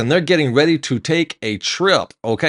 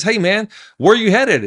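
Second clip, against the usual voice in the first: higher-pitched, eager friendly hail; excited, pitched-up greeting; excited passenger; 6 semitones higher than usual. Acted. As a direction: call over like a thrilled traveler. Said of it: "He's excited about this".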